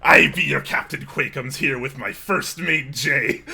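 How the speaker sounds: loud hammed-up pirate captain; booming mock pirate voice; pirate accent. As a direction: bellow it like a cartoon pirate captain. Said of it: "start with some kind of pirate theme".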